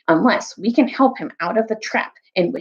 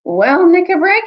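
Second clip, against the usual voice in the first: louder and higher, calling out; spoke aloud; 11 semitones higher, 8 dB louder than usual. A narrator's reading. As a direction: address the group aloud. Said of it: "Then Caspian spoke aloud".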